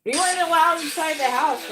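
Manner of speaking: high, loud, gleeful outburst; loud exclaim. Real, not acted. Echoing the clip's line to her viewers, loud and high.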